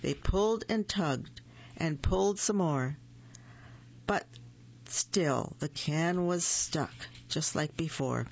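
usual speaking voice

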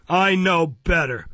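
slow, loud, high-handed contradiction; Mister Fang, scornful. A narrator's reading. Magistrate overruling his officer, scornful.

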